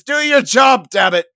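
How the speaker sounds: loud, high-pitched shouted command; angry order. Acted. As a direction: shout an exasperated order at a colleague. Medic orders colleague to work.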